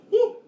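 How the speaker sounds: loud, rising "whoo" of excitement; excited whoop. Retold or imitated. Dad whooping when his son speeds up, with an excited holler. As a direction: whoop like you've been challenged. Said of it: "And I was like".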